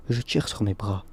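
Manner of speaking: ordinary delivery, nothing marked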